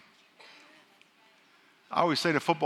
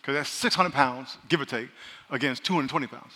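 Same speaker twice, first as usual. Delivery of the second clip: normal talking voice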